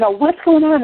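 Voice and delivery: bewildered husband. Retold or imitated. Husband walking in on the steam, bewildered. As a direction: voice a startled husband's confusion. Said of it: "he's thinking".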